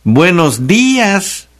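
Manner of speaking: Buenos días, slow, with the E and Í pushed high; exaggerated syllable stresses. Shown as a demonstration. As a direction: overdo each accent in Buenos días, stretching it. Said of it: "tendríamos que escribir así". (Buenos días, lento, exagerando cada acento)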